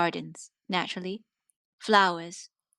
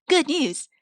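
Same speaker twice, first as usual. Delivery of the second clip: cheery announcement; 9 semitones higher, 6 dB louder than usual. Acted. Cheery announcement in corporate letter.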